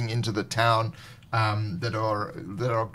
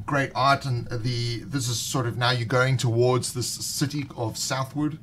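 ordinary talk

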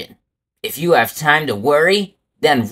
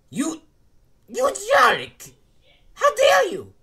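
outraged. Acted. Offended villain outburst.

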